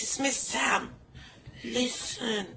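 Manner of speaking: ordinary talk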